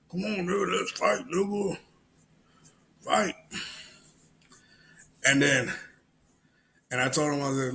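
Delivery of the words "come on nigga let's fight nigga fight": high-pitched put-on goading; mock challenger. Challenger pestering a busy cashier to fight, goading. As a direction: egg him on in a raised mock voice.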